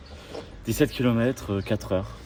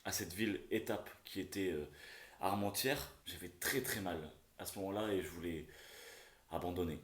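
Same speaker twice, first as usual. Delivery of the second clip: quiet confession; 2 semitones lower, 14 dB quieter than usual. Real, not acted. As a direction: confess it softly, close to giving up. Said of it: "j'avais vraiment envie d'abandonner". (aveu à voix basse)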